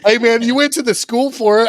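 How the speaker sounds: high, loud playful ribbing; teasing. Real, not acted. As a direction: rib your buddy loudly. Teasing friend loudly.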